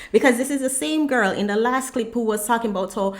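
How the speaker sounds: ordinary delivery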